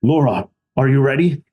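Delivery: put-on a light, half-hearted Russian accent; hint of a big metallic Russian dude. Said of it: "thick Russian accent that I won't be able to do".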